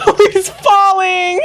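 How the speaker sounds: very high, slow and loud, breaking into a cry; crying-out wail. Real, not acted. Player wailing as the character falls, high and slow. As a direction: wail it out like you can't bear to watch.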